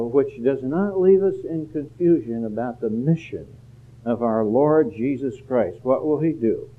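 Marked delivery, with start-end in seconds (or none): none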